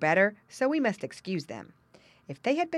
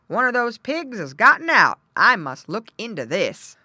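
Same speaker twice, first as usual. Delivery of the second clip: loud, raised exclamation; exclaiming farmer; 5 semitones higher, 10 dB louder than usual. A narrator's reading. Farmer finds a pig gone, exclaiming. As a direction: announce the discovery loudly. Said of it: "he exclaimed".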